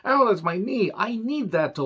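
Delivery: mock a sore wrestler clutching his knee; hammed-up pained whine about his knee; whiny wrestler. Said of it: "He's like".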